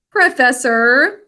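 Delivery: usual speaking voice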